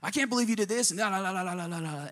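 high, indignant mock outburst; angry caller. Upset church member on phone to pastor, mock indignant. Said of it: "She was angry at first".

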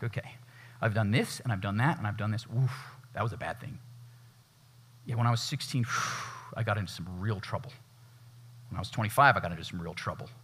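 muttering a tally of past sins under his breath; soft, sighing list of old wrongs; quiet self-reckoning. Acted. Man tallies his sins to himself.

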